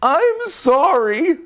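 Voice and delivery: high, slow bird voice; sorry in a high birdy voice; tall bird. Acted. Tall bird apologizing in a high voice. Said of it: "said the very tall bird".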